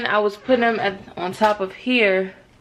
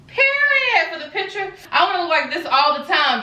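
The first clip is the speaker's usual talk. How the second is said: high squeak; 9 semitones higher than usual. Real, not acted. Sucking stomach for picture.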